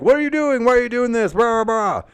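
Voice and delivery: high, whiny mock protest; mock Akira whine. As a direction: squeak it out like a flustered wrestler. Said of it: "kind of like".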